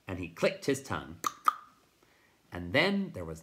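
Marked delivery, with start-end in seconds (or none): none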